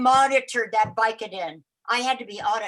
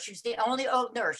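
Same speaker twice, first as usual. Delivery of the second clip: ordinary talk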